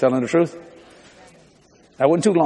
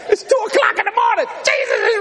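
excited shout. Retold or imitated. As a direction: shout it out like you can't contain it. Shouting his excitement in the retold story.

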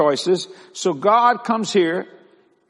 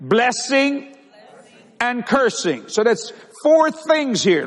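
higher-pitched, forceful pulpit delivery; emphatic preaching; 6 semitones higher, slower than usual. Real, not acted. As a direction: proclaim each word to the congregation. Preaches on the verse.

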